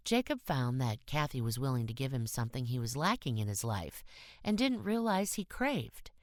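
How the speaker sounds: ordinary delivery, nothing marked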